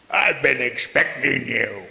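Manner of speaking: low, quiet sinister demon; menacing demon voice. Retold or imitated. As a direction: purr it like a lurking demon. Demon voiced in retold scene.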